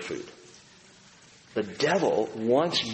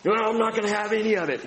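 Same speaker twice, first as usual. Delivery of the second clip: stubborn refusal; 9 semitones higher, faster than usual. Retold or imitated. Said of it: "Saul refusing Jesus".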